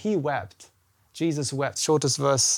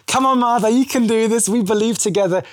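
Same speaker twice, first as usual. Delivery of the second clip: loud, high, rousing encouragement; rally her faith, louder and higher; urging encouragement; 4 semitones higher, 5 dB louder than usual. Acted. Jesus urging Martha to believe, rousing and loud. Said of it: "he's drawing it out of her".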